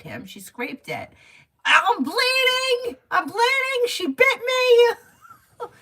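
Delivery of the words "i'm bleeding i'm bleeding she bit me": very high, loud, drawn-out whine; mock hysterical wailing. Sister mocking her brother over a dog scrape, mock hysterical wail. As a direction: wail it like an overdramatic victim, stretching every word. Mimics brother's hammy complaint of being bitten.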